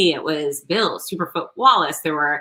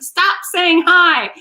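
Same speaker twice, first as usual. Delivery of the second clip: slow, much higher and louder mock of mom; exasperated mom; 13 semitones higher, 6 dB louder, slower than usual. Mimicking her mother scolding her, slow, high and loud. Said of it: "She's like".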